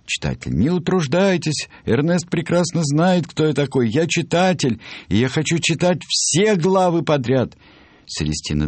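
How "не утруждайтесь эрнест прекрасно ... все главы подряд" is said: very high-pitched, adamant character voice; insistent Reader. Acted. Reader refuses to let the author skip a chapter, high insistent voice. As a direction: push it up high and demand everything.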